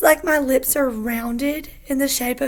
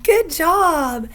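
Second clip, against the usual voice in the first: bright, loud "good job"; excited praise; 4 semitones higher, 7 dB louder than usual. Real, not acted. Praising the child.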